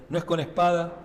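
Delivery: normal talking voice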